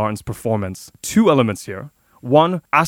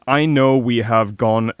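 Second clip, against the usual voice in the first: normal talking voice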